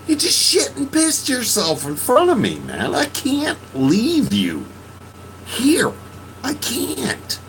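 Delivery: high, strained, desperate pleading; anguished plea. Retold or imitated. To friend who soiled himself, high desperate plea. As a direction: crack with desperation. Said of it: "I said".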